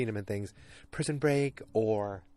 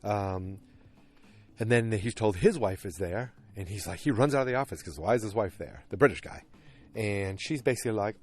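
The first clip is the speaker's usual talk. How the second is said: normal talking voice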